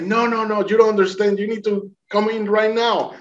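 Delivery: high-pitched, insistent retold command; acted urgency. Boatman ordering the swimmer aboard now, urgent and high. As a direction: urge him, pitched well above normal.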